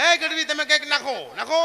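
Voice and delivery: high, loud jinn voice hailing Gadhvi; jinn calling out. Acted. The jinn to Gadhvi, a loud high summons. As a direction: bellow like a taunting jinn.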